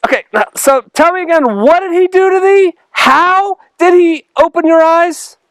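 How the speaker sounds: interrogating Pharisees. Acted. Pharisees grilling the healed man again, high and pushy. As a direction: press him like a suspicious interrogator.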